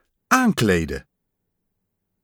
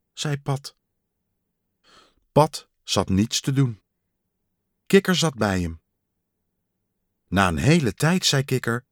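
ordinary delivery, nothing marked

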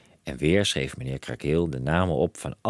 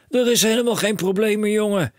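high, loud brush-off; no time to wait; impatient cry; 6 dB louder than usual. A narrator's reading. Father cutting off his son, high impatient call. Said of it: "riep meneer Krakeel".

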